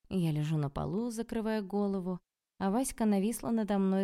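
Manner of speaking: ordinary delivery, nothing marked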